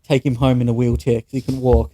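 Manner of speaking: usual speaking voice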